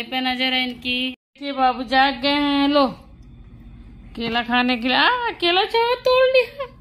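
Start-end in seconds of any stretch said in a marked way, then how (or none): 5.0-6.7 high-pitched playful surprise; startled squeal of words; surprised exclamation. Real, not acted. Baby grabs banana, mother exclaims.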